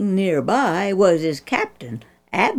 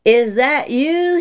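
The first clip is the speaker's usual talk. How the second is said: loud, higher, slow call of recognition; the king calls out into the night; 6 semitones higher, 4 dB louder than usual. Acted. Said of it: "he called".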